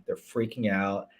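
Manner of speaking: ordinary talk, nothing marked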